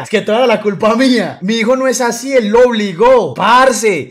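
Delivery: loud, high mock wailing protest; protesting mother. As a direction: imitate a mom hotly defending her son. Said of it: "me decía". (madre que protesta a gritos, imitada)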